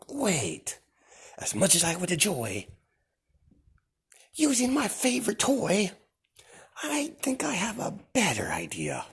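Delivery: high, hushed musing; sinister whisper. Acted. Deku musing about cutting himself, whispered and creepy. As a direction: purr it half to yourself.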